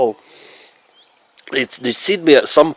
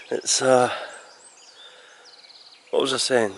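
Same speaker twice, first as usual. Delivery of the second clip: drowsy, losing the thread; sleepy, words trailing off; half asleep; 7 dB quieter than usual. Real, not acted. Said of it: "I'm still half asleep".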